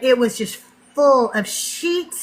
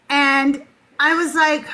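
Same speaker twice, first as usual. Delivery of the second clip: ordinary delivery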